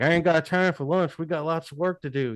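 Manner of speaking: a hint of a gruff old worker; imitating Bob. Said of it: "He's, he's like".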